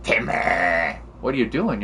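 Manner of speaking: ordinary talk, nothing marked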